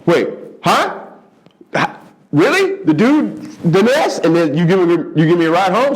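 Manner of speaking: high, stammering astonishment; star-struck kid who can't believe his luck; flustered disbelief. Retold or imitated. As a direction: babble in amazed disbelief. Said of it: "young comic stunned".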